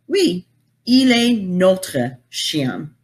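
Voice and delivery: "Oui, il est notre chien" slow, careful French; slow French answer. Shown as a demonstration. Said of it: "the answer".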